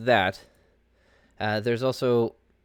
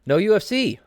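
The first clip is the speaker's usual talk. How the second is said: hyped announcement; 11 semitones higher, 6 dB louder than usual. Real, not acted. Loud opening announcement.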